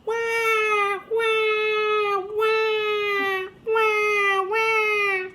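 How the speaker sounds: put-on high wailing baby cry; baby crying wah. A narrator's reading. Baby in the knapsack bursts out crying wah. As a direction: wail like a bawling baby. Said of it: "started to cry".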